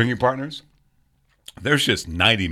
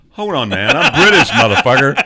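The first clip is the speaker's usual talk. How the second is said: loud, laughing mock outrage; playful outraged yell; mock indignant shout; 4 semitones higher, 8 dB louder than usual. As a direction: bellow it in fake offense through laughter. Mock-offended British outburst.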